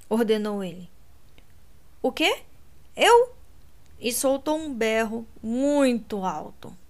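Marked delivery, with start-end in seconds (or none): 2.0-3.3 alarmed question jumping much higher and louder; startled. A narrator's reading. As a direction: caught off guard, voice leaping up. Kate startled before the pull.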